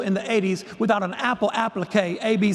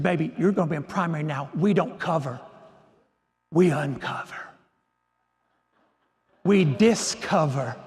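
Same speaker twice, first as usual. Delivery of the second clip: lower, deliberate Southern mentor; genteel Southern voice; 5 semitones lower than usual. Retold or imitated. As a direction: pronounce it like a wise Southern lady. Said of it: "this lovely Southern genteel voice".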